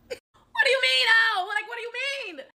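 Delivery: very high, loud exclamation; exasperated high shout at his reaction; outraged yell. Retold or imitated. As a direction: yell it in high-pitched disbelief. Outraged reaction to his calm reply.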